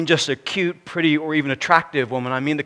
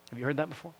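normal talking voice

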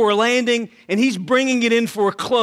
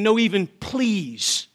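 ordinary talk

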